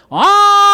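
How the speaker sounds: loud high siren imitation; rising mock siren howl; old siren wail. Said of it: "The sirens that used to go".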